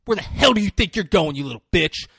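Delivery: high, loud mock-furious challenge; acted screaming outburst. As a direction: imitate someone suddenly exploding at a lawyer. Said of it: "he's just fucking screaming".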